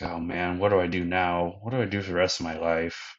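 ordinary talk, nothing marked

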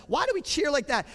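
usual speaking voice

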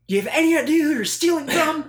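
high, loud movie-quote yell; acted shout. Bank manager confronting robbers, acted shout. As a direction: belt out the line as an outraged bank manager.

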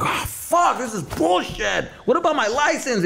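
high-pitched, whiny retold outrage; exasperated protest. Customer being kicked out of club, exasperated.

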